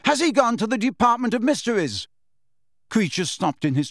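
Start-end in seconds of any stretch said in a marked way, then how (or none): 0.1-2.1 yelling. Acted. Harry shouting after the elf, urgent yell. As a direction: call him back, desperate to know. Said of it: "Harry yelled after the elf".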